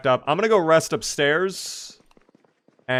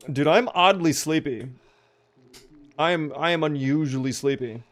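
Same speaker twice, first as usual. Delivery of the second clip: ordinary talk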